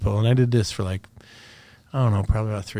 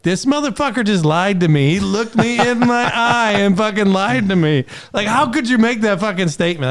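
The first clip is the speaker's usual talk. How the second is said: high, disbelieving inner rant; incredulous inner voice; 10 semitones higher, 4 dB louder than usual. Retold or imitated. Soldier silently fuming at a commander who lied to his face, high and incredulous. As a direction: inner disbelief, pitched up and a touch faster. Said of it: "That's what I was thinking".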